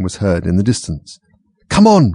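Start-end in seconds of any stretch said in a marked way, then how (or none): 1.7-2.1 high loud urging; loud high call; cried out. A narrator's reading. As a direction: hurry them along loudly. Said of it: "cried the Gryphon".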